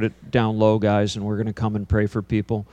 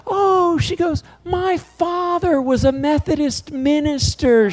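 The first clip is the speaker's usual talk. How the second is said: high, slow mock prim lady voice; slower than usual. Preacher imitating a prim passenger, high and slow. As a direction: lightly play the prim minister's daughter. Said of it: "she looks at me and she goes".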